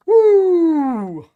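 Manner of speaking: long, high whoop falling at the end; loud whoop. Real, not acted. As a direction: let out a big high "woo". Whoops after laughing at his joke.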